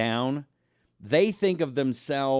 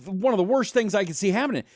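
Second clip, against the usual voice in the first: usual speaking voice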